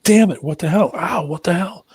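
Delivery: sudden loud high yelp of pain; startled outburst, swearing in pain; retold pained yell. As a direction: blurt it out like you've just been stung. Stung by wasps, retold yelp.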